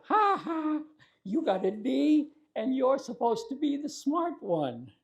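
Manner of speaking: high, loud, gloating "ha ha" jeer; mocking taunt. Big brother taunting his crying sister over her bad test, mocking and loud. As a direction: rub it in like a smug big brother.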